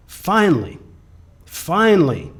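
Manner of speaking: building relief at last; emphatic finally. Real, not acted. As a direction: land each finally with a hard drop. Emphatic turn in the story.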